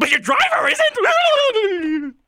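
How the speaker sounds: Jim Carrey-style Dark Knight. Pretend. Cohost imagines a Dark Knight villain line done in a high, slow, hammy shout. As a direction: ham up the gangster line like a cartoon villain. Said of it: "imagine if Dark Knight, he was like that".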